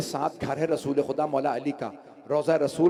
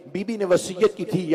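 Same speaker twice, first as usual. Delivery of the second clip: ordinary delivery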